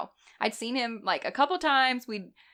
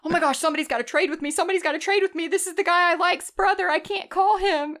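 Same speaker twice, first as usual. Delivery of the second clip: high, rushed panicked whine, slowing on the last line; mock panic; 9 semitones higher, 4 dB louder than usual. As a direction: sound like you're begging someone to swap with you. Panicked quote at her call list.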